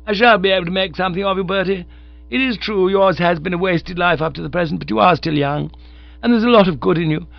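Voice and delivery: high, fast and loud; motherly bossy young woman. Acted. Honoria plans to reform Bertie, high and bossy. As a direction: take him in hand like a governess.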